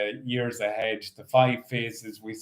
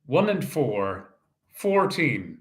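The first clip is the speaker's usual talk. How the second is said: bingo caller. Acted. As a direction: call each ball out steadily. Calls bingo numbers.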